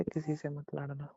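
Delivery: ordinary talk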